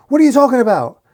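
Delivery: high, loud alarmed demand; shouted question. A narrator's reading. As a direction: shout back in confusion. Armed gang demands news from screaming henchman.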